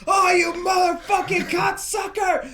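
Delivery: high-pitched retold shouting; mock angry yell. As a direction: yell abuse at a closed door. Friend banging on door, yelling.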